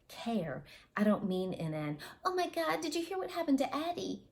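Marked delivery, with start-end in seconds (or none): 2.2-4.2 high, breathless gossip voice, much higher; gossipy gasp. Retold or imitated. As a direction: gasp it like juicy school gossip. Mock gossip quote, "kind of way.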